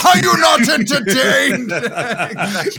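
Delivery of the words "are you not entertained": loud, high echo through a laugh; echoing the gladiator quote, loud and high; gladiator shout. Acted. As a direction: roar it back laughing. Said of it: "echoes the quote".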